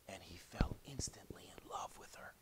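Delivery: soft, low and breathy; near-whispered narration; hushed storytelling. A narrator's reading. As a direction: whisper it tenderly to the listeners.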